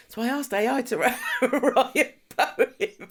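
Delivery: imitating a caller cracking up, high and breaking into laughter; laughing confession. Retelling the daughter's phone confession about the poem, laughing. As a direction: struggle through the retold confession, laughing. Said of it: "She was laughing. She was like".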